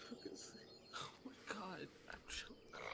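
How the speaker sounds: breathless whisper after laughing. Real, not acted.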